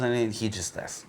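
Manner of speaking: plain reading voice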